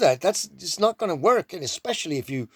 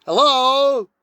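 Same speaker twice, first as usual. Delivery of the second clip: mock call; 9 semitones higher, 8 dB louder than usual. Mock phone call to dad.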